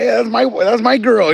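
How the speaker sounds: put-on joking claim over your girlfriend; mock claim. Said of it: "I'm like".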